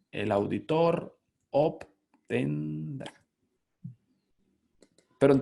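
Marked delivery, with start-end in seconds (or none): 0.1-3.1 El auditor obtendrá drawn out slowly, word by word; slow reading aloud. Shown as a demonstration. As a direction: read the order out slow and deliberate. (lectura lenta y marcada)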